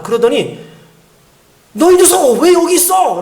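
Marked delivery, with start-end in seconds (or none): 1.7-3.2 loud, high, drawn-out acted cry; father's outcry. Father hugs his found child, high emotional outcry, acted. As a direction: cry out like a dad finding his lost child.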